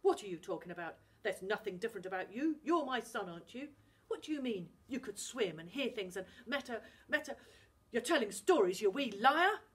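loud, rising to a shout; furious father refusing to hear it; angry Scottish dad. Acted. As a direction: call the boy a liar.

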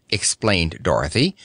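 plain reading voice

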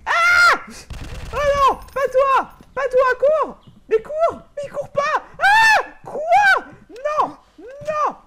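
high frantic yells at the screen; panicked screaming. Real, not acted. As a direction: yell in real panic. Chased, screaming at character.

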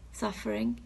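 ordinary delivery

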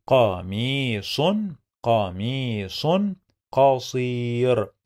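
"قميص قصير" with long-held vowels, word by word. Shown as a demonstration. Teacher sounds out phrase slowly.

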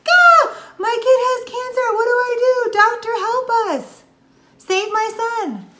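high, loud, frantic pleading; mock your own panic at the diagnosis; panicked parent. Mother retelling her panic over her son's cancer, high frantic plea. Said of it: "because I was like".

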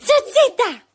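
a big playful call up high; high sing-song call. Real, not acted. Aunt calling toddler by name.

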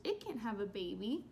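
ordinary delivery

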